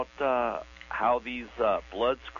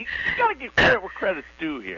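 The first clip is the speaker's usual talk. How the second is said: excited correction; 8 semitones higher, 5 dB louder than usual. Real, not acted. Caller insists, correcting host.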